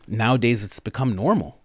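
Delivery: ordinary talk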